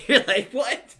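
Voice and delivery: loud high laughing what; shocked laughing what. Retold or imitated. Podcaster acting disbelief, loud laughing shout. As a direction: blurt it out in disbelief.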